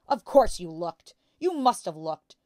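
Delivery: loud, relentless ranting; angry shout. A narrator's reading. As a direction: keep shouting her down. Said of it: "the father shouted".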